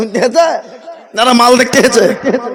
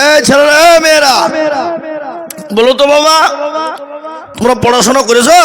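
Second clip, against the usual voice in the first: calling out to class; 5 semitones higher, faster than usual. Acted. Professor calls out to the class.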